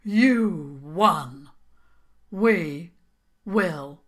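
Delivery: loud troll voice, word by word, fading to the end; defeated troll king. Acted. Troll King conceding to the princess, halting and sulky. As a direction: give up one word at a time.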